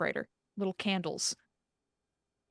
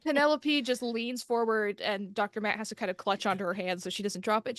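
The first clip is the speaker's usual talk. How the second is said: ordinary talk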